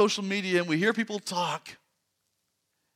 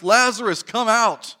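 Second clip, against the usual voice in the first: a commanding shout, much louder; loud call; 6 semitones higher, 11 dB louder, slower than usual. Acted. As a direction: call him out of the tomb with full voice. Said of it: "Jesus called out in a loud voice".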